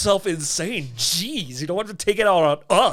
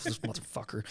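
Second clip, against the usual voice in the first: much softer, lower muttered curse; muttering; 7 semitones lower, 17 dB quieter than usual. Retold or imitated. Pacing in the basement cursing his friend, low mutter. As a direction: grumble it under your breath. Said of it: "muttering to myself".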